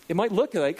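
normal talking voice

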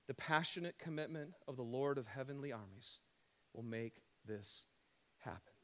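quiet reverent reading that fades at the end; solemn scripture reading. Acted. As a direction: read the prophecy like a proclamation. Reads Isaiah prophecy aloud.

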